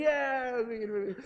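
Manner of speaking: mock staff yes. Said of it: "Everyone's like".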